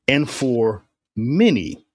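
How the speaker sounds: "many" set apart and drawn out slowly; slow down and lean on "many"; stress on MANY. Shown as a demonstration. Commentator reading the missal to flag a changed word, stressing "many". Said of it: "I emphasize the word many".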